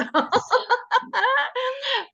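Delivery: high, hearty burst of laughter; real laughter; big laugh. Real, not acted. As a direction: laugh out loud at your own joke. Laughs at own steak joke.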